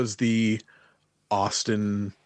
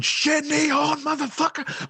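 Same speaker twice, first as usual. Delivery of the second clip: high, excited ringside yell; mock hyped-up shout from ringside; hype yell. Clarku cheering Gabe on, high hyped yell. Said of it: "Clarku's going".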